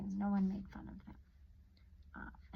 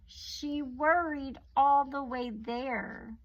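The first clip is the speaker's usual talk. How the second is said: slow emphasis; 4 semitones higher, 6 dB louder, much slower than usual. A narrator's reading. Narration building worry.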